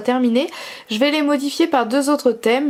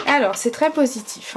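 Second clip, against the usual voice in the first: ordinary delivery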